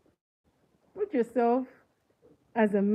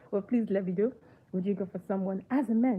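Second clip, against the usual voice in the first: ordinary talk, nothing marked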